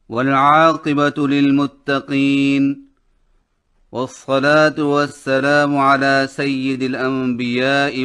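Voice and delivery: measured, formal Arabic opening recitation; recited invocation. Real, not acted. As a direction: recite the blessing slowly and formally. (तिलावत के अंदाज़ में दुआ)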